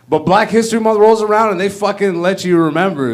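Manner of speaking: normal talking voice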